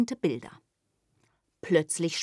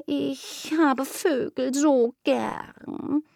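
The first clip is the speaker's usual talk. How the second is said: slow, dreamy, longing cat; curious kitten; 8 semitones higher, much slower than usual. Acted. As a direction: purr the question slowly. Kitten longs for birds.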